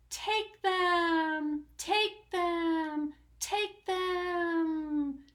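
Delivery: the cave calling him inside; teasing echo. Acted. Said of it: "came back the welcome sound".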